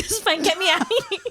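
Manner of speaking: high, laughing fake calm; laughing mock calm. Dropped off mid-street by the driver, laughing. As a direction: laugh while faking it's fine. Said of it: "I'm like".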